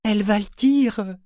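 high, loud whine; bewildered wail; plaintive, flustered. A narrator's reading. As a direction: complain helplessly, voice pitched right up. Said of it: "se plaignit Clapeau désorienté".

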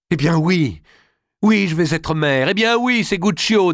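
high, loud outburst climbing in pitch; defiant confession. Acted. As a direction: throw the truth at your mother. Daughter hurls out her confession.

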